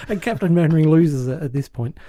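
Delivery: ordinary talk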